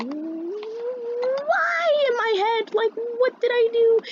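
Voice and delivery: very high, stretched-out bewildered whine; confused. Retold or imitated. Student baffled by classmate's reaction, confused squeal. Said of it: "I'm so confused".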